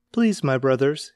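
usual speaking voice

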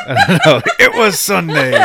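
giggling through the words; can't stop laughing while reading. Real, not acted. Breaking into laughter mid-reading.

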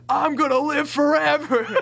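high, loud laughing brag; giddy mock-villain boast. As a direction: crow it like an unbeatable giant. Kid bragging in backyard game.

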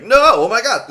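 high, loud panicked cry; panicking. Retold or imitated. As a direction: burst out in alarm for a friend. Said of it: "Jake panics 'cause he's like".

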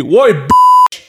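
sudden loud high bark; coming in hard. Retold or imitated. Confronting a litterer the hard way, loud shout. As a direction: snap at him like you mean trouble. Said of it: "do you come in hard?".

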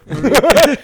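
loud, high, laughing repetition; real laughing outburst. Real, not acted. Friends joke about a forgotten character's name, laughing outburst. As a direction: crack up loudly on the name.

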